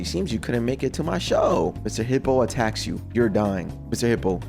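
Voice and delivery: normal talking voice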